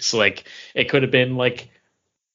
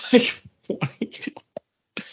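laughing whisper; slower than usual. Retold or imitated.

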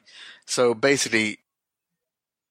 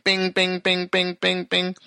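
notification pings; 8 semitones higher, slower than usual. Retold or imitated.

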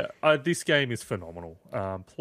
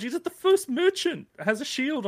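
mimicking friend; 11 semitones higher than usual. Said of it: "But you're like".

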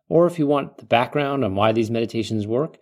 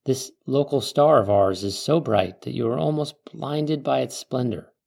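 calm meditative tone; 3 semitones lower than usual. Acted. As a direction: ease the listener into calm. Leads a guided desert meditation.

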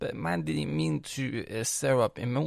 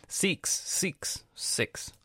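American-sounding; 8 semitones higher, much slower than usual. Retold or imitated. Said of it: "I sounded American".

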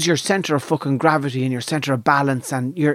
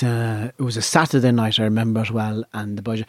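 usual speaking voice

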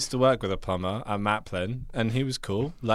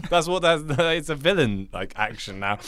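ordinary delivery

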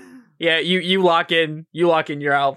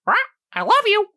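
squawking bird; faster than usual. Acted. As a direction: shriek it like a pet bird.

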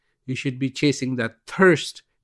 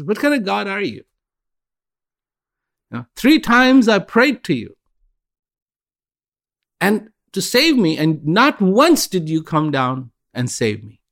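indignant reproach; 8 semitones higher than usual. Acted. Drowned woman scolds God for never saving her, higher and indignant. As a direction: throw it at God as betrayal.

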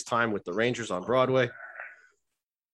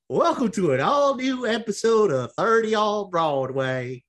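slow, higher country drawl; 6 semitones higher, slower than usual. Shown as a demonstration. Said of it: "are you moving to a hick town now".

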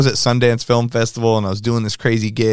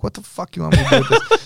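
incredulous retort; 8 semitones higher, faster than usual. Retold or imitated. Handed a business card mid-gig.

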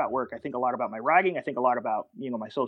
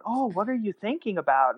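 higher-pitched take on a curious woman; lightly voiced woman; 5 semitones higher than usual. Woman asking man his thoughts, higher imitated voice. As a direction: lightly put on a woman's wondering voice. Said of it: "this woman's like".

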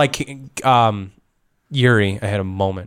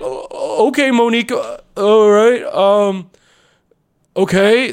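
nervous guard; 9 semitones higher, 5 dB louder, much slower than usual. Retold or imitated. Goliath guard answering.

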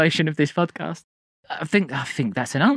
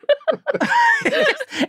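high laugh. Real, not acted. Big laugh at joke.

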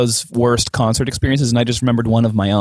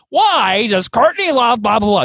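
high, drawn-out comedian impression; put-on high, slow joke-telling voice; Neil Hamburger; 9 semitones higher than usual. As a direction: imitate a comic stretching out a joke setup. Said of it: "He was like".

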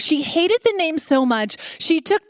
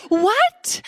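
ordinary talk

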